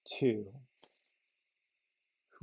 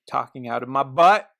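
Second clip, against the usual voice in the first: much louder, blurting it out; 9 dB louder than usual. Real, not acted. Vlogger admitting he's rambling, blurted loudly. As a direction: suddenly blurt it out loud. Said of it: "I don't know why I said that so loud".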